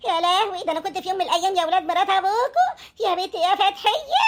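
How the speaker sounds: high, tearful wailing plea; wailing lament. Acted. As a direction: wail as if mourning yourself. تصرخ مستغيثة من الجاموسة. (ولولة باكية مرتفعة)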